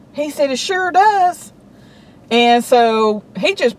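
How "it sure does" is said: slow, high, offhand agreement; a slow careless it sure does; drawled shrug. Retold or imitated. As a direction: let it go with a lazy shrug. Attendant shrugs it off.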